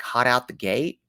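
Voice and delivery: ordinary talk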